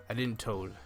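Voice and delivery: normal talking voice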